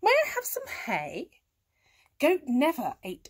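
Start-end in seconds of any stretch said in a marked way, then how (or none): 0.0-1.3 high rising request; cat asking. A narrator's reading. Cat asking a farm animal for things, high cat voice. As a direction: ask politely in a lifted cat voice. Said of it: "said Kat".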